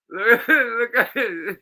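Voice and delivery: high, slow, laughing words; laughing hysterically. Real, not acted. Pointing at the mess, laughing hysterically. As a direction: squeeze it out through laughter.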